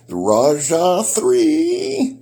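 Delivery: slow, high, loud product reveal; drawn-out announcement. Real, not acted. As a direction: stretch out the knife name. Announcing the knife name.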